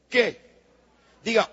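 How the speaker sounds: usual speaking voice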